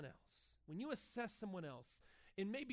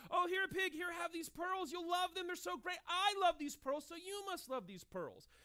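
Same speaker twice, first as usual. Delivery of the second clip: mock gushing offer; 7 semitones higher than usual. Mock offering pearls to a pig.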